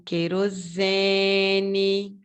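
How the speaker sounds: "querosene" with a long, held SE; slow "querosene", lingering on its second-last syllable; stressed syllable drawn out. Shown as a demonstration. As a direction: stretch the SE in "querosene". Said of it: "estão na penúltima sílaba".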